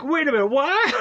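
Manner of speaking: high, loud startled "what?"; stunned disbelief. Retold or imitated. Viewer reacting to the movie twist, stunned. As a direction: caught off guard by the twist. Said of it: "So I'm like".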